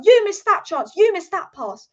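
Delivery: lightly acted scolding coach; demanding Ten Hag. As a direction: imitate a manager calling out each mistake. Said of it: "Ten Hag is like".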